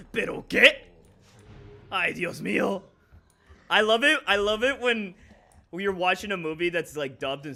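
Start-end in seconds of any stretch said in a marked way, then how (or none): 0.1-0.8 loud, high mock dub-actor outrage; over-the-top Spanish dub voice; dubbed voice. Mimicking dubbed game line. 1.9-2.8 a touch of hammed dub drama; light mock Spanish dub. Mimicking dubbed game line.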